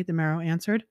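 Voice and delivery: ordinary delivery, nothing marked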